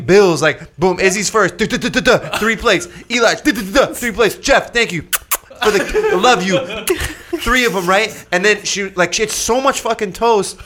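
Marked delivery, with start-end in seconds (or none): none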